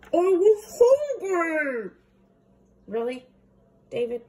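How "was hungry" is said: put-on louder, slow, high childish whine; whiny excuse. David whines to Mom, loud slow high. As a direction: drag it out like a hungry kid.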